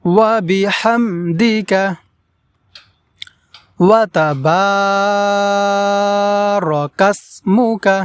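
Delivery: slow chanted prayer recitation, vowels held. Shown as a demonstration. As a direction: recite the dua slowly and devoutly. Full recitation of the dua.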